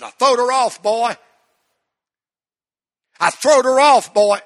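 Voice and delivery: high, loud joyful country father; country dad. Retold or imitated. Preacher voicing his overjoyed dad, loud high country exclamation. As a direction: burst out with it like a glowing country dad. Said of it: "we talk real country".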